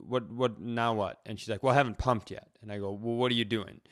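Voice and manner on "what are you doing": ordinary delivery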